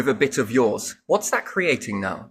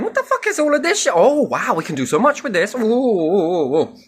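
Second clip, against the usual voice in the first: very high, squealing amazement; excited mock voice, climbing high; a cartoonish high voice marvelling; voicing the other bloodline; 8 semitones higher, 3 dB louder than usual. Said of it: "it's going".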